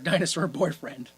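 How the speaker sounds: normal talking voice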